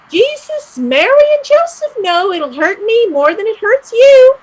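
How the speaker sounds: loud, high scolding nun; shouting nun. Acted. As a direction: rant like a furious nun. Shrill nun voice with ruler.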